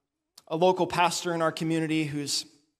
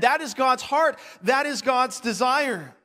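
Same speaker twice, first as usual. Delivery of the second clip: impassioned preaching; 7 semitones higher, slower than usual. Acted. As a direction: proclaim it with conviction. Preaching at full force.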